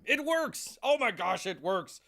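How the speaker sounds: high excited cry of success; thrilled it finally works. Real, not acted. Device finally working, excited.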